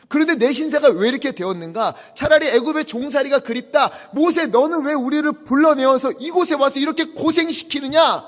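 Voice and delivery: loud, high reproach; bitter outcry. Retold or imitated. Israelites accusing Moses in the desert, loud bitter outcry. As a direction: blame Moses at full voice. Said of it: "이렇게 말해요".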